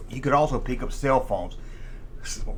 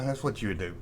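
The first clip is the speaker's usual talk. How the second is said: normal talking voice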